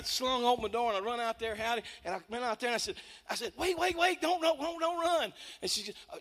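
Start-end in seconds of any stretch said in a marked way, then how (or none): 3.6-5.3 high-pitched, repetitive plea; urgent pleading. Retold or imitated. Man chasing a frightened lady, urgently pleading. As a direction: stop her before she gets away. Acted pleading with fleeing woman.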